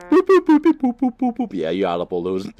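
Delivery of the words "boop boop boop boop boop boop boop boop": high, loud boop-boop noises; rapid cartoonish honking with the voice; imitated beeps. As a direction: rattle off high-pitched mock beeps. Imitating horn beeps while driving.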